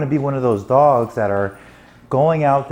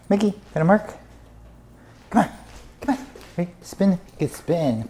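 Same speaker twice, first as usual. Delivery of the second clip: coaxing the dog; 6 semitones higher, 3 dB quieter than usual. Real, not acted.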